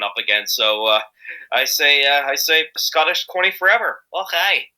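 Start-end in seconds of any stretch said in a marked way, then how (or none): 2.8-4.7 higher-pitched Scottish cheer; hammed-up Scottish exclamation; playful Scottish. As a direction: proclaim it with a Scottish flourish. Playful Scottish cheer, och aye.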